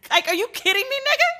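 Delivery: high, outraged are-you-kidding-me; mock disbelief, pitch shooting up on the last word; incredulous. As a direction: voice jumping way up in disbelief. Incredulous outburst at refusal.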